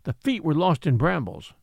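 ordinary delivery

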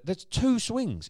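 two drawn out, high and loud; stress on two. Real, not acted. Dad complaining about the playground, stretching "two" high and slow. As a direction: lean hard on "two". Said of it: "emphasis on two".

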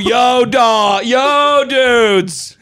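loud, laughing Boston guy hollering; Boston accent. Pretend. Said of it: "Some guy from Boston".

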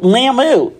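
mocked American "Lamu", stretched and high-pitched; mocking American accent. Kenyan friend teasing the American visitor, mocking American accent. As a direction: make fun of an American saying "Lamu". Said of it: "making fun of my American accent".

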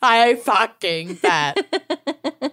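drawn-out slow emphasis; slow emphatic. Pretend. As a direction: stretch every word out.

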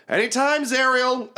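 high calling yell. Acted. The archer shouts to Zariel, a high call. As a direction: shout it across the battlefield. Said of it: "you hear her just yell".

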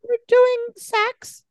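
high, hammed-up girly phone voice; squeaky fake girlfriend; mock girl voice. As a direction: play the new girlfriend, way up high.

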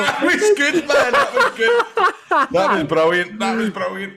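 high, helpless laughing praise. Real, not acted. Host delighted by a friend's riddle guess, laughing. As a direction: praise it through real laughter. Cracking up at the wrong answer.

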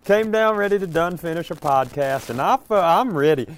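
put-on light hillbilly accent. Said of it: "your hillbilly voice".

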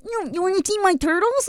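put-on high, sing-song clueless kid; mock kid voice. Friend mocking clueless kids, high silly kid voice.

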